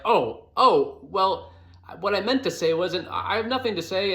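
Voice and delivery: mock awkward correction; awkward fumbling. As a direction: stumble over your words like a nervous first date. Awkward first meeting, acted out.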